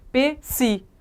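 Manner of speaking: "P, si" as two separate beats, pê then si; sounded-out syllables. Shown as a demonstration. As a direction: sound out "P, si" deliberately with a gap.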